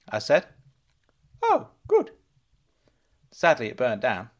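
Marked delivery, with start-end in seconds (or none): 1.4-2.1 put-on much higher, loud excited old uncle voice; high, loud eager uncle; Uncle Claude calling out. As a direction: squeal his name like a giddy fussing uncle.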